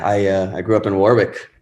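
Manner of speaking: usual speaking voice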